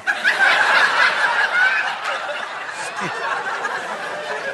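high airy burst of laughter; big laugh. Real, not acted. Preacher laughing at his own wrestler comparison, airy high laugh.

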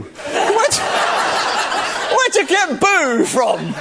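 high, laughing disbelief. Real, not acted. Leader teasing whoever said boo, laughing. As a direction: laugh through the teasing question.

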